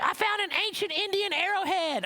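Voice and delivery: put-on high, thrilled child's voice; excited little girl. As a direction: blurt it out like a proud kid. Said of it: "she was so excited".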